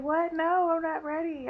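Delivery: high, loud startled objection; alarmed protest. Retold or imitated. Patient to surgery staff who ask if she's ready, alarmed protest.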